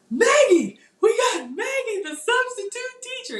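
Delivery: high, loud excited calling out; excited shout. Retold or imitated. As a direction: announce her like a big arrival. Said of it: "Uncle Stu shop-, stopped the show".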